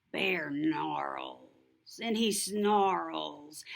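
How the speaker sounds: usual speaking voice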